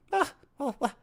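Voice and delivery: high, mock French complaint; French accent. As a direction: play an offended Frenchman. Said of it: "where I'm from, we say".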